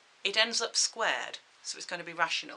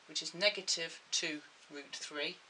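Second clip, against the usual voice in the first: normal talking voice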